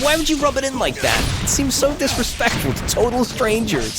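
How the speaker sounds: high-pitched exasperated questioning; indignant rant. Real, not acted. Player complaining about rude winners, high indignant rant.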